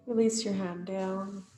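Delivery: ordinary delivery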